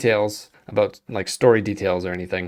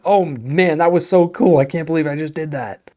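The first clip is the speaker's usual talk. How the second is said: higher, louder gamer excitement; thrilled; 6 semitones higher, 5 dB louder than usual. Acted. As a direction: gush like you just pulled it off. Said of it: "make you go".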